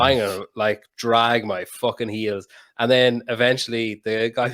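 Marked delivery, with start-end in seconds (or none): 0.0-2.5 dragging the words out slowly on purpose; slow drawn-out taunt. Retold or imitated. Spiteful man stalls the impatient woman, slow. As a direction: stretch it out to needle her. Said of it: "Like, I was like".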